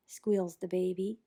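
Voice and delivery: plain reading voice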